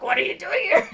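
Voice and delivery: high, loud hammed-up outrage with a laugh; mock upset. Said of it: "And she's, like, so upset".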